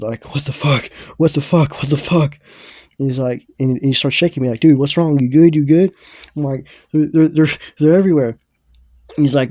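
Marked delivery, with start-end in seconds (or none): 0.3-2.4 imitated panic, higher and louder each time; horrified. Teller reliving a bad salvia trip, horrified. 4.6-5.9 imitated urgent check, higher and much louder; alarmed friend. Friend shaking the teller mid-trip, alarmed. 6.9-8.4 stammered panic, high and loud; scared. Retold or imitated. Teller mid-trip to his friend, scared. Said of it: "I start getting scared".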